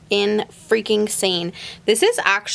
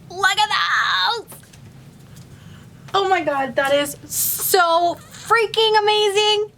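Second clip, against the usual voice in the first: high-pitched, drawn-out squealing awe; real starstruck excitement; gushing delight; 9 semitones higher, slower than usual. Real, not acted. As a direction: squeal over it, stretching the words. Gushing over the gifted ears.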